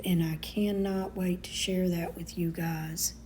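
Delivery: soft whisper to viewers; whispered teaser for the spring booth. Real, not acted. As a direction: let them in on a secret, whispering. Excited secret to viewers.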